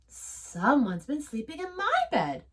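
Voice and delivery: usual speaking voice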